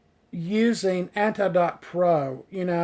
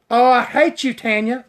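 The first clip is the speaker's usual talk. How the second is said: loud, higher playful grumble; mock hatred; 5 semitones higher, 7 dB louder than usual. Stylist to viewer Tanya, mock-hateful teasing. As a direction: tease a friend with pretend outrage.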